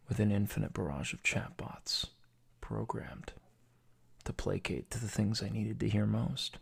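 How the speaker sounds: soft, half-whispered dramatic confession; quiet, brooding reveal with heavy pauses; hushed dark monologue. Acted. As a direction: let it sink into a hush. Mock-dramatic conspiracy monologue.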